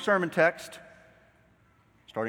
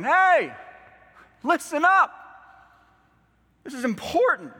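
loud, high urgent shout; raised voice, demanding to be heard; attention-getting call; 13 semitones higher, 9 dB louder than usual. Acted. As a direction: call out to grab a wandering listener. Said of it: "wanting to get your attention".

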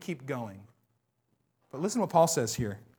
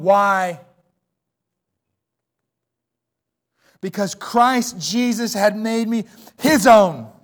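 louder, driving sermon delivery; full-force preaching; 4 semitones higher, 9 dB louder than usual. Acted. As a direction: preach it to the back row.

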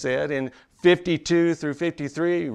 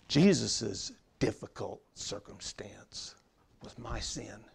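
dropped to a near-whisper, much softer; hushed whisper; 5 semitones lower, 11 dB quieter than usual. Acted. Preacher confides to the congregation in a hushed whisper. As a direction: pull them in close, barely above breath.

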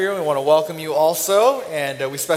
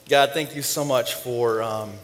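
offering prayer; 2 semitones lower than usual. Real, not acted. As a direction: pray simply, searching for the words. Prays over the offering.

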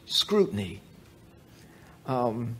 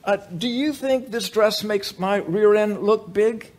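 lightly voiced wife; 5 semitones higher than usual. Wife modelling her new dress for her husband, lightly voiced. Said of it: "she turns around and says".

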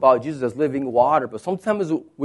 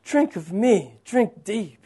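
soft, spaced-out invitation; hushed, unhurried appeal; Jesus inviting; 6 semitones higher, 4 dB quieter than usual. Acted. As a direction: voice Jesus gently, with long pauses. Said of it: "And he says".

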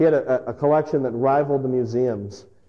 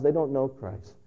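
ordinary talk

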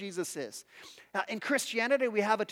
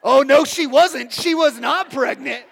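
indignant correction; 4 semitones higher, 10 dB louder, slower than usual. Retold or imitated. Cashier correcting his pregnancy guess.

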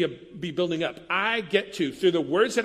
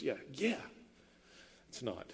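usual speaking voice

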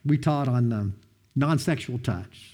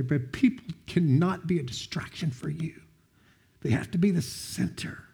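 whispering a mentor's earnest words, voice barely above breath; hushed whisper; 6 dB quieter than usual. Retold or imitated. Mentor confiding to Brad after weeping over a stranger, in a hushed whisper. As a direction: lean in and breathe it softly.

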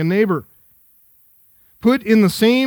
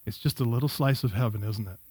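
soft hushed; 7 semitones lower, 11 dB quieter than usual. Real, not acted. Fond aside about sleeping kids.

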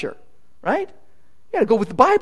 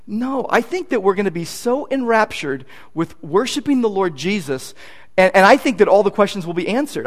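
normal talking voice

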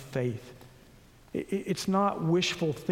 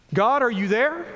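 pleading cry; 4 semitones higher, 5 dB louder than usual. Acted. Voicing a doubting believer's prayer.

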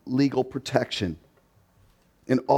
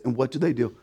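ordinary talk

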